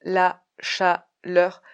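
slow, stiff robot voice. Shown as a demonstration. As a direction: speak like a robot. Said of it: "parler comme un robot".

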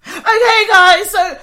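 very high, very loud mock rallying call; over-keen shouting. Imitating perky colleague after a night shift, shouting. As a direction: burst out like an over-peppy team leader. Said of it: "I'm not shouting".

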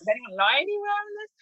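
high-pitched incredulous question; retold self-doubt. Retold doubt about award application.

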